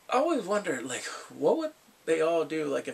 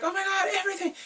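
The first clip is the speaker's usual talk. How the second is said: high squeaky gushing voice; squealed, mock-excited quote; faster than usual. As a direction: gush it up high like a giddy guest. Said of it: "And they're like".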